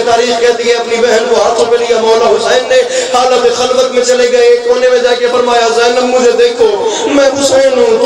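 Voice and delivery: high, fervent elegiac preaching; impassioned preaching. Acted. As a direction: push the narration up in pitch as the grief mounts. Majlis climax preaching.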